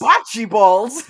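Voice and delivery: thick New York accent on "Bocce balls", "Bocce" said "Botchi". Shown as a demonstration. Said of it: "With a nice thick New York accent".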